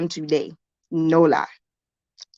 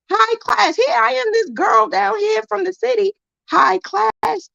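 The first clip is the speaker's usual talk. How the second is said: much higher, put-on posh society voice; high-class lady; 14 semitones higher than usual. Vlogger mocking her fancy yarn hook as a snobbish high-class lady. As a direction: play a snooty uptown lady. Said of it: "Furl's high class".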